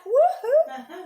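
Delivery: high, loud, laughing whoop; excited woohoo. Real, not acted. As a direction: cheer it out, delighted and laughing. Showing off chef's hat.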